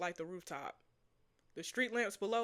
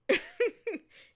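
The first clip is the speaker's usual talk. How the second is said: squealing giggle, much higher; high laugh; 13 semitones higher, 8 dB louder than usual. Real, not acted. Laughing at her own line.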